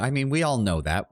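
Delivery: ordinary talk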